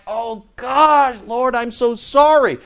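high, drawn-out mock anguish; acted remorse. Believer apologises to the Lord after sinning, hammed-up remorse. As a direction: plead an apology, stretched and high. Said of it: "when you do sin, you're like".

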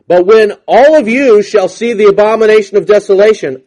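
loud, high preached verse; preaching emphasis. Acted. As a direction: proclaim it to the room. Emphatic scripture reading.

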